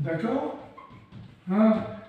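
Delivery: usual speaking voice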